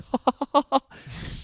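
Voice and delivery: high, staccato "ha ha ha"; exaggerated high fake laugh; mock audience laughter. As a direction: imitate a crowd suddenly cracking up. Imitates audience laughing weeks later.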